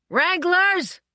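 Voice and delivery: high, loud alarmed shout; an assistant director shouting for the wranglers, horrified; horrified call. A narrator's reading. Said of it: "in horrified tones".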